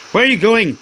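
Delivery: high shout after her. Acted. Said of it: "he shouted".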